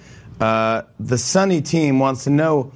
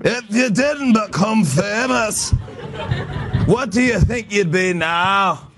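high, slow Scottish lilt; Scottish accent; 7 semitones higher, slower than usual. Acted. Interviewer reads a fan question from Scotland, hammed-up Scottish accent. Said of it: "do your Scottish accent again".

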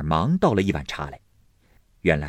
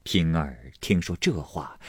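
ordinary delivery

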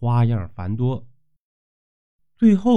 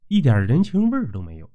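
usual speaking voice

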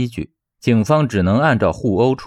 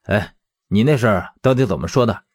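usual speaking voice

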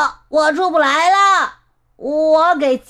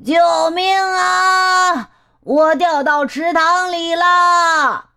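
high, drawn-out distress shout; long, ringing high holler; cry for help; 8 semitones higher, 4 dB louder, slower than usual. A narrator's reading. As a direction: call far across for rescue. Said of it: "那声音叫着说".

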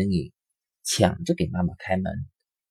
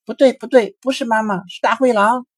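alarmed; 13 semitones higher, 6 dB louder than usual. A narrator's reading. Bunnies spot the wolf.

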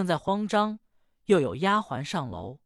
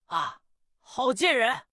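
furious master; 4 semitones higher than usual. Acted. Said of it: "怒气直冲".

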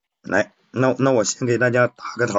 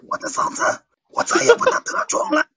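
much higher, cracking laughing consort voice; 安陵容 impression. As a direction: wail as the ruined-voice concubine, breaking into laughs. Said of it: "我先抛砖引玉一下". (高声模仿安陵容，忍不住笑)

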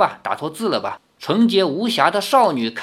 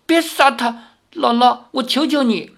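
higher, a hint of trembling plea; 7 semitones higher than usual. A narrator's reading. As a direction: beg grandmother for his life. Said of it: "颤声道". (颤抖着哀求)

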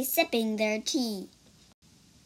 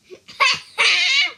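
high, loud mocking laugh; 11 dB louder than usual. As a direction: laugh at him from above. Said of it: "pointed and laughed".

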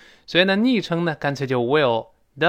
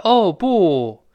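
loud, higher, theatrical "oh no" in translationese; mock dubbing-style exclamation; 6 semitones higher, 6 dB louder than usual. Teacher mocks a common film translation, exaggerated stilted exclamation. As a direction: exaggerate it like a stilted subtitle line. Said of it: "这个翻译的不地道".

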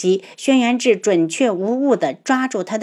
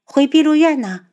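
put-on higher, lighter young woman voice; heroine voice; 7 semitones higher, 4 dB louder than usual. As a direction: answer bright and light. Heroine answers, high far voice.

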